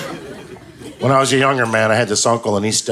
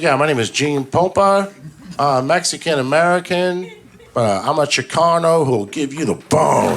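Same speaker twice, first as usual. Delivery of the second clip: put-on casual, halting kid's recitation; schoolkid reciting. Nephew introducing himself to the class, unsure and casual. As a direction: fumble through it lightly, pitched up at times. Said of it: "Like, first day of school".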